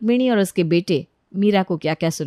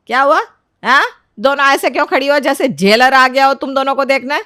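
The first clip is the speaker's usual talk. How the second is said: loud, higher-pitched questioning; stern mother-in-law, demanding; 5 semitones higher, 6 dB louder than usual. Acted. Mother-in-law catches both daughters-in-law standing stiff, sharp and demanding.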